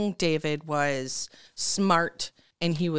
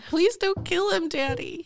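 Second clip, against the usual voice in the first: very high, hammed-up little-girl begging; mock-pleading daughter; 12 semitones higher than usual. Daughter begging Daddy to spare her love, hammed-up high pleading. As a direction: beg Daddy in a squeaky, put-on whimper. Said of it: "if my dad was in my face".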